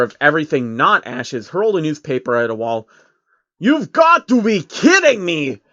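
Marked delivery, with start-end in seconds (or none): 3.6-5.6 high, loud, slow outraged scream. A narrator's reading. Soros screams at the newspaper news, outraged. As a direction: explode in disbelief. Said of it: "he screamed".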